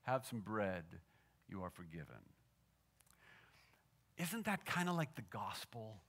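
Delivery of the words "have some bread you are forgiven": soft, low words of pardon; quiet, tender forgiveness with a gentle smile. Retold or imitated. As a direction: offer it gently, dropping softer at the end. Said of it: "gentle smile, he said".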